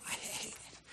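whispered I hate it, almost no voice. Real, not acted. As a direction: breathe it out as a whisper. Repeating I hate it.

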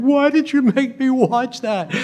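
high, loud mock-sobbing wail. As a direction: wail it like a weepy sitcom friend. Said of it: "she's just sobbing".

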